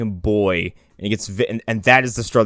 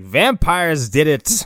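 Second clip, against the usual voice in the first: slow deadpan verdict; 4 semitones higher, much slower than usual. Retold or imitated. Mimicking the doctor at crime scenes.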